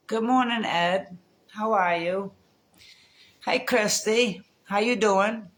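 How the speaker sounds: greeting neighbour Ed from the yard, calling out. Real, not acted. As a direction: call out cheerily across the street.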